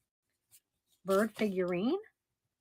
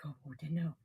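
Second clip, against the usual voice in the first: whispered, quieter apology; husband's whisper; 4 semitones lower, 5 dB quieter, faster than usual. Retold or imitated. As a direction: whisper it back like a caught husband. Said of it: "he's like".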